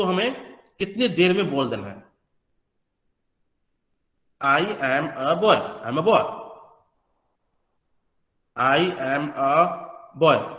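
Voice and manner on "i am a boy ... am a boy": put-on I am a boy, each word dragged out slowly. Shown as a demonstration. Teacher mimics students: I am a boy, too slow. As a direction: plod through every word evenly. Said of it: "आपने बोला, time लिया".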